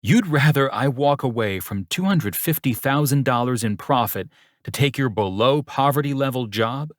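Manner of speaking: incredulous indignation. A narrator's reading. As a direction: throw the absurd offer back at him. Suitor incredulous at insulting offer.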